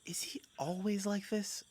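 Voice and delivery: unvoiced, slow whisper. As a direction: whisper it aside, slowly. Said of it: "Kat actually goes".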